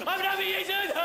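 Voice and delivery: high, loud pantomime audience yell; shrieking at the stage, high and loud; panto crowd shout. Retold or imitated. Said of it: "It's us going".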